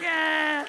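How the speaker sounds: put-on loud, high, held wail of children; mock kids' scream. All the kids react to dead Chucky, mock scream. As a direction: imitate horrified kids screaming.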